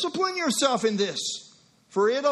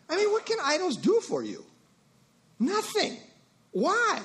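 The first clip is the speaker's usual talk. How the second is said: rhetorical pitch-up; 9 semitones higher than usual. Acted. Rhetorical questions on idols.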